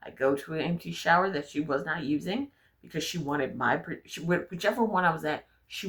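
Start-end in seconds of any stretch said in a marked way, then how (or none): none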